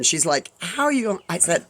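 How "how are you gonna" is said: ordinary delivery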